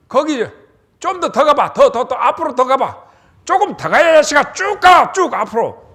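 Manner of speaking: urgent shouted directions, pitched high; calling out far. Acted. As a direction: yell across the field to keep him running. Jonathan calls to the arrow boy.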